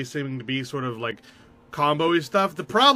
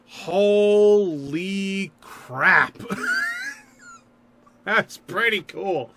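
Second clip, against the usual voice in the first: amazed; 5 semitones higher, 3 dB louder, much slower than usual. Real, not acted. Reacting to a new card.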